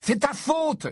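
high-pitched accusing outburst; angry cry. A narrator's reading. Ron blames his brother for his cut thumb, angrily. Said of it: "s'écria Ron avec colère".

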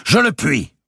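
high, loud and firm. A narrator's reading. Robert answering the king, high, loud and firm. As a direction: answer firmly, no doubt. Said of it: "dit fermement Robert".

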